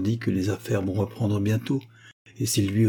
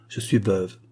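plain reading voice

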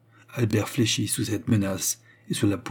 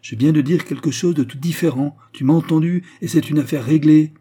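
furious; 7 semitones higher, 8 dB louder than usual. Acted. Albert's furious rant.

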